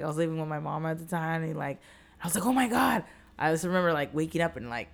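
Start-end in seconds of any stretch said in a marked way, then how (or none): 2.4-3.0 higher-pitched retold "oh my God"; freaking out. Woman recalls waking up after the date, freaking out. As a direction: exclaim it out loud, mock alarm. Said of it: "kinda freaking out".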